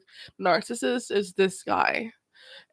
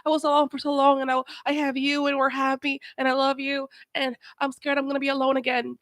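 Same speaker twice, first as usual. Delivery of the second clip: higher, pleading mock whine; mocking clingy narcissist; 6 semitones higher than usual. Vlogger mocks the narcissist's clingy apology, higher whine. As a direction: parody his needy guilt-trip, pitched up. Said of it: "they're like".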